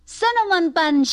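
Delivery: put-on high-pitched boyish call; mild storybook shout; eager shout. A narrator's reading. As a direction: call it out like an excited little boy. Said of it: "shouted Mike".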